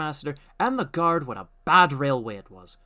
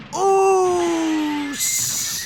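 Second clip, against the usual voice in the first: high, louder strained groan; 8 dB louder than usual. A narrator's reading. Thomas skidding with brakes held fast, groaning. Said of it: "groaned Thomas".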